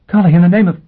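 high, loud shocked woman's cry; shocked exclamation. Acted. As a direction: blurt it out in disbelief. Gisbella shocked at the intruder.

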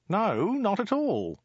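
high, slow, drawn-out no; high-pitched, stretched-out placating; humouring denial. Acted. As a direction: hastily reassure the boss in a high, slow voice. Humouring the Khan in awe.

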